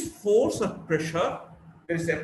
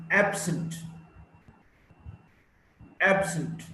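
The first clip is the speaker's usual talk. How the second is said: AB-sent, absent stressed on its opening AB; first-syllable stress. Shown as a demonstration. Teacher modelling adjective stress, absent as AB-sent. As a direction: stress the AB of absent, falling after it. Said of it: "stress is on the first syllable".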